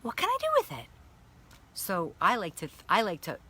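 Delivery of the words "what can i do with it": put-on very high, bright childlike squeal of delight; excited body voice. Her body discovering energy, speaking in a very high childlike voice. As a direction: voice your body like an eager little kid, way up high. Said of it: "My body is like".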